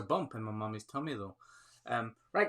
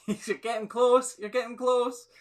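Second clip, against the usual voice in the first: teasing laugh; 11 semitones higher, 5 dB louder, slower than usual. Real, not acted. Teasing viewers near the answer.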